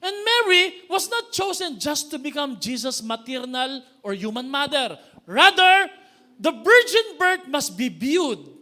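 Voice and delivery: loud, slow, emphatic sermon build; forceful preaching. Acted. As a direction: preach it out to the church.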